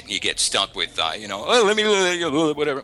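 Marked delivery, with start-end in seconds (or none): 1.5-2.8 high, loud mock caller babble; imitating rambling caller. Agent mimicking the caller he got stuck with, high and babbling. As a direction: jabber like a pushy amateur, pitched way up.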